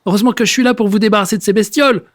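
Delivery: horrified bluster. Acted. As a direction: rattle it off in horror. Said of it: "brailla le voisin".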